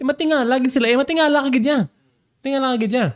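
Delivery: high, excited emphasis; amazed. Real, not acted. Preacher marvelling with the congregation at the crowd, excited.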